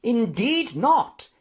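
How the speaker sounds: slow, high, loud contradiction; emphatic exclamation. A narrator's reading. Professor contradicting news of the death, exclaiming. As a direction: exclaim it, stretching both words. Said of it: "exclaimed Jaggles".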